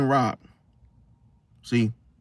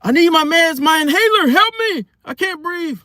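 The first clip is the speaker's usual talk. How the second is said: high, loud fake panic; hammed-up emergency, crying out for help; screaming and yelling; 12 semitones higher, 4 dB louder than usual. Shopper fakes an asthma attack as distraction, high frantic yelling. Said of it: "you screaming and yelling".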